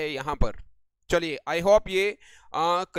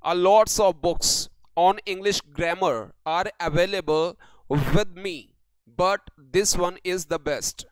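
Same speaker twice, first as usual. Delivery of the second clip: reads each word out very slowly for copying; slow dictation reading; much slower than usual. Shown as a demonstration. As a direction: spell out the sentence word by word like dictation. Dictating next sentence.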